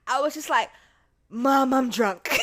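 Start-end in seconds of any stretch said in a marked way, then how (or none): 1.3-2.3 slow, loud admission; blurted confession. Retold or imitated. Daughter phoning mom to confess, slow loud blurt. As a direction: draw it out loudly before cracking up.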